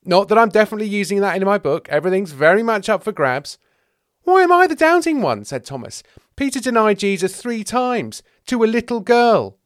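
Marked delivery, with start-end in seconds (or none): 0.0-3.6 loud, high, hurried disclaimer; raised, protesting caveat; defensive backpedal. Acted. John backpedals. 4.2-5.4 loud, very high whine of complaint; outraged, wailing question; indignant protest. Acted. Thomas protests the nickname. 6.3-9.6 loud, high protest; outraged tale-telling; indignant accusation. Acted. Thomas protests the nickname.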